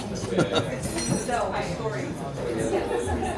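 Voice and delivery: short real laugh at the taste; amused laugh after the first bite. Real, not acted. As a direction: let a surprised little laugh out. Laughs after tasting eel.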